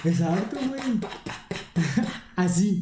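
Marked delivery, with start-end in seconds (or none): 0.8-2.3 quick playful "pa-pa-pa" gunshot noises; kid-style shooting sounds, laughing through them; mouth gunfire. Pretend. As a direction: rattle off fake shots with your lips, half laughing. Mimicking gunshots after "Pa.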